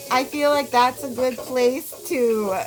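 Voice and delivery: usual speaking voice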